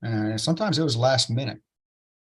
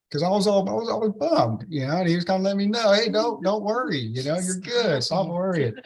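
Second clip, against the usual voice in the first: excited retelling; 8 semitones higher than usual. Real, not acted. Excited retelling God's reassurance.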